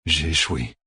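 low, subdued sigh of defeat; sighed admission. A narrator's reading. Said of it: "soupira Logain".

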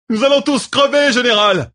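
high, loud cry of a doomed, euphoric officer; frenzied shout. A narrator's reading. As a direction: yell it wildly to your general. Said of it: "lança Lidrin". (cri exalté, très aigu et fort)

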